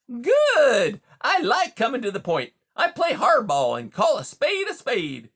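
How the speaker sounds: high, loud, hearty salesman-like patter; brash, chummy beaver. Acted. Beaver greets a wary mouse as a new pal, brash and chummy. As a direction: play a pushy, overfamiliar beaver, loud and high.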